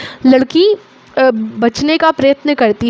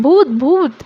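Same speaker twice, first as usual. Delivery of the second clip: mock shout; 2 semitones higher, slower than usual. A narrator's reading. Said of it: "नाचते हुए चिल्लाने लगते हैं".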